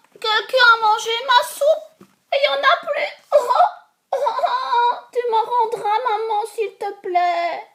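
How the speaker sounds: very high, loud, quick little bear with a laugh; baby bear voice. Acted. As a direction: squeal it like a giggly cub. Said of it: "le petit ours dit".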